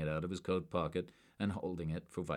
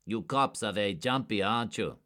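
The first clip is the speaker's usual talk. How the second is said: a touch of teasing amusement; mocking; 4 semitones higher, 5 dB louder than usual. A narrator's reading. Suspect mocking jumpy cops, lightly amused. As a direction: needle them lightly.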